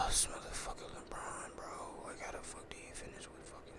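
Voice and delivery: whispered cursing to himself; very soft, breathy grumble; muttered exasperation. Real, not acted. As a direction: swear under your breath while checking. Muttering to himself while checking stats.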